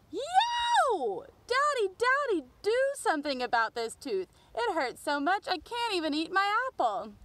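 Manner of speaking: put-on a boy yelling for his daddy about a sore tooth. Boy in pain yelling to father, high wail. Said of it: "and yelled".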